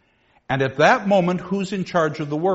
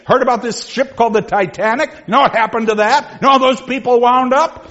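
rising rant; 8 semitones higher, 5 dB louder than usual. Acted. Squire ranting to his teacher about doom, louder and higher. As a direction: pitch climbing higher and louder each question.

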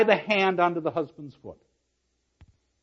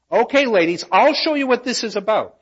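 commanding Kate; 7 semitones higher, 7 dB louder than usual. Acted. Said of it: "in token of which duty".

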